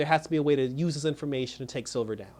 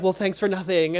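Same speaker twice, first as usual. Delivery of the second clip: imitating her annoyed, higher-pitched comeback; mock huffy retort; 4 semitones higher, 4 dB louder than usual. Sam snapping at the scared boy, mock huffy. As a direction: throw it out like an offended teen, lightly hammed-up. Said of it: "she gets mad".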